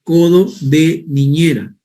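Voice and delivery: codo de niñera said slowly, each word spelled out clearly; slow emphatic term. Shown as a demonstration. Teacher to students introducing the term, slow and clear. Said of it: "también se le llama".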